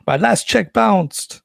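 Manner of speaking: slow, high, loud mock French; bad French accent. Euro Disney pleading over money, bad French accent. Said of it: "my bad French accent aside".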